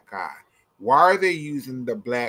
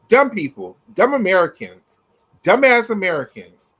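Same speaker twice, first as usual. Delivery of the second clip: loud, high-pitched insult, punched out; exasperated, emphatic name-calling; scornful rant; 7 semitones higher, 7 dB louder than usual. Real, not acted. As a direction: hit each insult harder, loud and unapologetic. Ranting insult, escalating, unapologetic.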